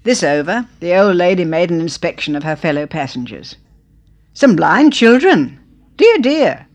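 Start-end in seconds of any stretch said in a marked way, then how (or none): 4.3-6.7 loud, high, slow pitying cry; pitying exclamation. Acted. Old lady to her husband, loud, high pity. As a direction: gasp it out in loud dismay.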